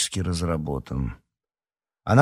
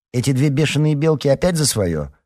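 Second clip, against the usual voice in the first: high, raised-voice complaint; exasperated scolding; 7 semitones higher, 4 dB louder than usual. A narrator's reading. Marina catches two girls squabbling, exasperated.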